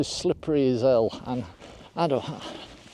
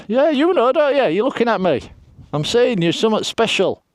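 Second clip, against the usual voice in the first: talking to a sheep; 9 semitones higher, 4 dB louder than usual. Real, not acted. Cooing to a sheep.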